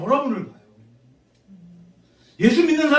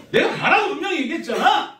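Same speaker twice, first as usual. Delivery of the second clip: higher mock yell, half laughing; replaying his snap at the driver, not fully earnest; retold angry outburst; 8 semitones higher than usual. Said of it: "내가 막 화를 냈어요".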